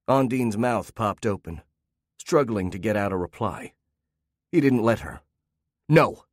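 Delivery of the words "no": forceful. A narrator's reading. Husband cuts wife off.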